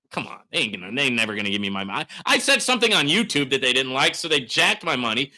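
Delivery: usual speaking voice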